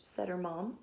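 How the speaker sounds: ordinary delivery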